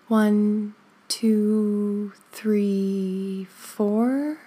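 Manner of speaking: slow, drawn-out counting with even spacing; guided breath count. Shown as a demonstration. Teacher counting listeners in to four and out from eight, measured and sustained. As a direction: count them gently through a long breath.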